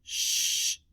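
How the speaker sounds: sh hissed with no voice; teacher isolating the opening sh of shop; sh sound. Shown as a demonstration.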